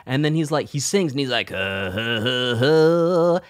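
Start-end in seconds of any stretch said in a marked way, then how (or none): 1.5-3.4 raspy sung grunts, swelling louder; rough rasping vocal run that builds; imitated raspy singing. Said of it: "the most raspy voice".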